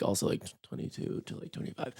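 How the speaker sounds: unvoiced whisper. Real, not acted. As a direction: whisper it ashamedly. Ashamed of ugly years.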